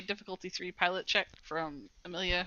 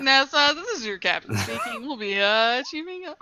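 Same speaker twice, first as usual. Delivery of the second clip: louder captain's cabin announcement; pilot cockpit headset; 4 semitones higher, 8 dB louder than usual. Pretend. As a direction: play the captain over the intercom. Mock captain announcement to crew.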